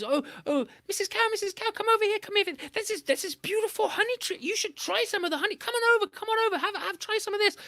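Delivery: high sweet wheedling spider voice; coaxing trickster. A narrator's reading. Anansi luring Missus Cow to the honey tree, high and wheedling.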